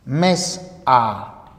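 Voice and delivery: "mes a" partido, con pausa tras "mes"; separación incorrecta. Shown as a demonstration. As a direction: di "mes", corta y suelta la "a" aparte. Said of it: "no vamos a decir algo como". ("mes a" cortado en mal sitio, con pausa)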